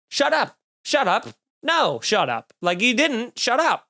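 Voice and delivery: high, disbelieving repeated shut up; incredulous shut up. Retold or imitated. Calling out the heckler's made-up address with high, disbelieving shut-ups. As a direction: wave off an obvious lie. Comic dismissing heckler's lie.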